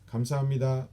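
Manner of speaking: 감사합니다 run together quickly, the last syllable falling; smooth and fast. Shown as a demonstration. Instructor showing how Koreans really say thanks, smooth and fast. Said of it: "like, smooth and fast".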